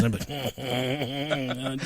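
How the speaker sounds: words dissolving into slow, quiet laughter; laughing. Real, not acted. Podcaster recalling his kid joke, laughing. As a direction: laugh through it, barely getting the words out.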